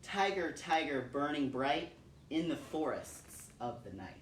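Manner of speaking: slow, grave poem reading with long pauses; solemn recitation. Acted. Reciting William Blake for her son, solemn measured recitation. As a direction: let each question hang in the air.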